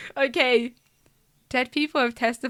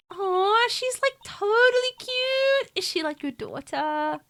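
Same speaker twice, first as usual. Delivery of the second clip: much higher, slow, gushing; valley girl voice; 7 semitones higher, 4 dB quieter, slower than usual. Acted. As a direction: coo it like a bubbly teen. Reading a valley-girl character line.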